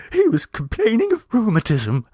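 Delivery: high tearful mother trying for a chill on "rheumatism"; a would-be chilling tone, mildly done; chilling inflection. A narrator's reading. Mrs Mummerby retells to Miss Gilpert, attempting a chill. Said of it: "trying to throw a chilling inflection".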